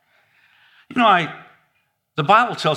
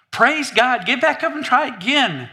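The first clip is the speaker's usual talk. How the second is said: high, rousing cheer; excited encouragement; 8 semitones higher than usual. Acted. Cheering on someone who fell while walking, excited. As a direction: urge them on like a proud parent. Acting out cheering on a fallen walker.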